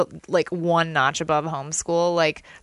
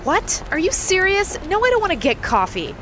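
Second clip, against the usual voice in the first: incredulous; 11 semitones higher than usual. Real, not acted. Incredulous refusal.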